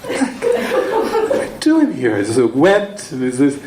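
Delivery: very high, slow mock bewilderment; bewildered, laughing. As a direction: voice his disbelief through helpless laughter. Retells his bewildered thought, laughing.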